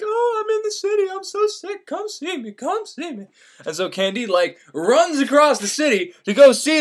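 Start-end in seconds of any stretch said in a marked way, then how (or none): 0.0-3.3 high, soft, swooning lady voice; Cunegonde's letter. Retold or imitated. Letter forger fakes Cunegonde to lure Candide, high swooning voice. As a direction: play the fake sick lover, high and soft. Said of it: "this asshole writes him a letter from Cunegonde".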